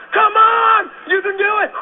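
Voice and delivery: high, loud pep-talk shout; loud cheering. Retold or imitated. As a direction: cheer them on hard. Pumping up the recruit.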